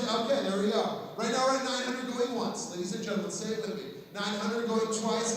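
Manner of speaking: loud auctioneer calling sold. Real, not acted. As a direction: close the sale with a shout.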